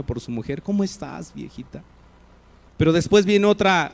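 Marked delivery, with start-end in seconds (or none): 0.6-1.8 softer, loving ¿Cómo estás, viejita?; tender newlywed greeting. Shown as a demonstration. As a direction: greet your new wife with soft affection. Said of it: "Están recién casados ... mucho afecto y cariño".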